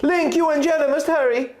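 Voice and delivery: high, urgent little fairy voice; a tiny fairy calling out in a hurry; high-pitched fairy urging them on; fairy crying out. Acted. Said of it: "Navi cried".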